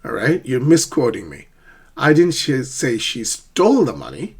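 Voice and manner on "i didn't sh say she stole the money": "stole" punched with a rising end; stress on STOLE. Shown as a demonstration. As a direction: lean hard on "stole", as if another word was meant. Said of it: "You see how stress".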